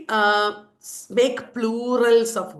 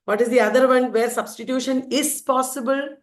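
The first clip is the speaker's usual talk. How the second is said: usual speaking voice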